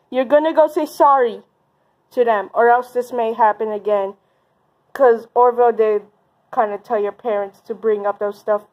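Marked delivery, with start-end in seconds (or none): none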